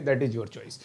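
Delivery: ordinary delivery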